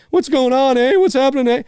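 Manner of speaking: high, loud hammed-up Canadian eh; cartoon Canadian eh. Mocking US satire of Canadians, hammed-up eh accent. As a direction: overdo the eh like a cartoon Canuck. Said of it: "the use of the word "a".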